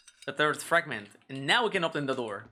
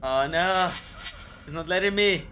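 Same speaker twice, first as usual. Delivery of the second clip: being chased; 3 semitones higher than usual. Real, not acted. Panicking while chased in game.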